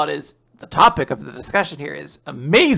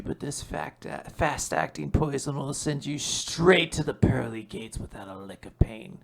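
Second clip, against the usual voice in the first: villain whisper; 3 semitones lower, 8 dB quieter than usual. Acted. Villain plots poisoning in whisper.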